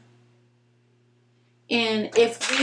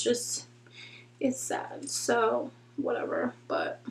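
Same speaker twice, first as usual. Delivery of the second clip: trailing whisper; 11 dB quieter, slower than usual. Real, not acted. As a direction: fade to a soft whisper.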